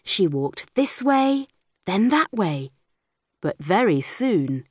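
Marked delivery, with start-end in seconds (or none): none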